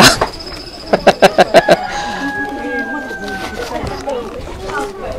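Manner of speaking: long, very high laugh; real extended laughing fit. Real, not acted. Laughs at having no money.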